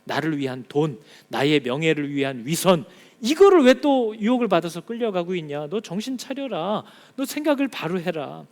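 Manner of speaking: stern voice of God; God rebuking the church. Acted. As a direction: warn them sharply as God.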